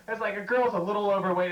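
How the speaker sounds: usual speaking voice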